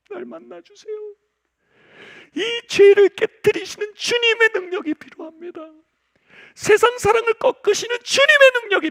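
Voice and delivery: high, loud crying prayer; loud high wailing prayer to God; desperate prayer. Retold or imitated. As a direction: plead out loud, stretched.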